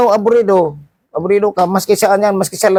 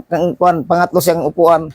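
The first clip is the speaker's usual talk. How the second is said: ordinary delivery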